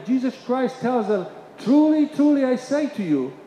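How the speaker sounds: loud, high preaching voice, building to a peak; preaching at full force. Acted. Pastor proclaiming to his congregation, loud full-force preaching.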